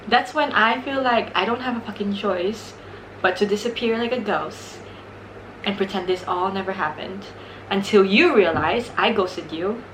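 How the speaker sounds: ordinary delivery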